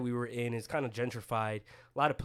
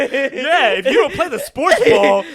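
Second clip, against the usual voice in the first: loud, high mocking meathead voice; dumb jock voice; 11 semitones higher, 14 dB louder than usual.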